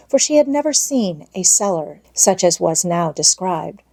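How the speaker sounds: plain reading voice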